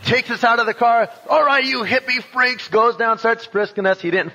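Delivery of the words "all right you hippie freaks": high-pitched mock police officer; tough cop. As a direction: act out a cop rounding up hippies. Cop confronts the hippies, acted.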